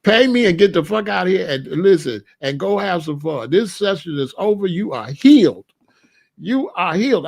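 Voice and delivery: loud, exasperated dismissal; mock psychiatrist yelling. Psychiatrist throwing the patient out, loud and fed up. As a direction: bark the patient out of the office. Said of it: "I'd be like".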